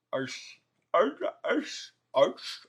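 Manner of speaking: repeated "Arse." in a playful British accent, bouncing up and down; mock British arse. Shown as a demonstration. Wrestler playing with the British word "arse" alone on camera.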